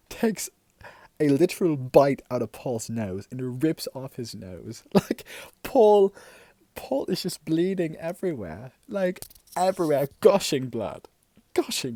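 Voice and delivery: loud, high, emphatic storytelling; worked-up. Real, not acted. Telling how the dog bit Paul, worked-up. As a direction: hammer out the gory climax loudly.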